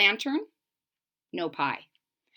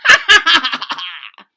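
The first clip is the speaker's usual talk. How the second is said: wicked laugh; 3 semitones higher, 9 dB louder, faster than usual. Acted. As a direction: cackle wickedly at the old man. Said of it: "he laughed wickedly".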